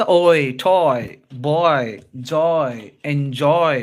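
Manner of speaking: ఓయ్, టాయ్, బాయ్, జాయ్, ఎంజాయ్ each drawn out and falling; modelling the diphthong. Shown as a demonstration. Teacher listing example words for ఓయ్, modelling the glide. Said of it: "ఈ విధంగా".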